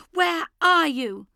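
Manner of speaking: high, loud panicked scream. Acted. Sister screams for their mother, high and loud. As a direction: sister screaming for mum across the dark. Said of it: "her sister is screaming".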